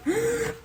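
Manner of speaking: high gasp of delight; sharp gasp. Real, not acted. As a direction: gasp in surprise. Cookie dough works.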